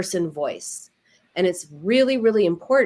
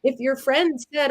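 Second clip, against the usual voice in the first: ordinary talk, nothing marked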